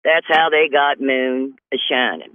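normal talking voice

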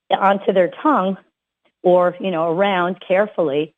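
usual speaking voice

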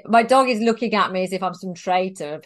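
ordinary talk, nothing marked

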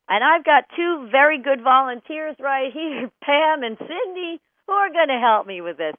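usual speaking voice

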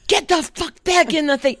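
put-on high, sharp yell at a child; yelled order. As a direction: shout out the car window. Yelling at toddler from car.

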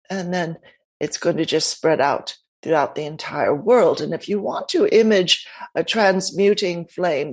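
calm, unhurried guiding into stillness; guided meditation. Acted. Host leads a short meditation.

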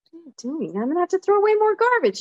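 high, quick annoyed complaint; fed-up wife scolding; exasperated protest. Retold or imitated. Scolding husband wetting newspaper.

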